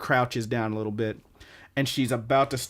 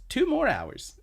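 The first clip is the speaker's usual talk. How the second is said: much higher put-on character voice; high imitated declaration; 13 semitones higher, slower than usual. She answers about extending their time, high imitated declaration. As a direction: lift it way up in pitch, imitating her. Retold character's gleeful request.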